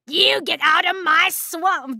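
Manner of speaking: badly faked Scottish ogre shout; awful Scottish accent. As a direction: try a Scottish accent and miss. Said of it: "That's an awful Scottish accent".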